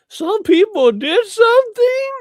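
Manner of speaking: very high, loud, mocking squeak; mock Omar voice. Host imitating Omar mid-thump to his guest, in a very high mock voice. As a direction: parody her line as shrill as you can. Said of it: "would you be saying".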